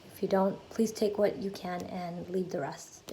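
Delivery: ordinary talk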